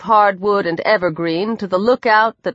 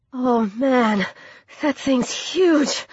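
slow, soft, shaken awe; quietly rattled and overawed; shaky voice; 3 semitones higher, 4 dB quieter, slower than usual. A narrator's reading. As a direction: say it unsteadily, drawing it out. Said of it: "his voice shaky".

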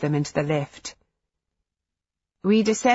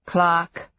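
warning tone. A narrator's reading. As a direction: say her name as a warning. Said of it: "Will's voice carried a warning".

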